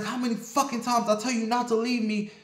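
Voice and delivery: high-pitched acted tirade; mock scolding without extra volume; retold angry scolding. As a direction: imitate a furious boyfriend's scolding, pitch up. Retold boyfriend scolding during beating.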